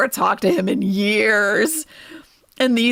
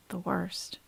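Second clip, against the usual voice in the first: hushed grief; 11 dB quieter than usual. Real, not acted. Grief over a dying pet.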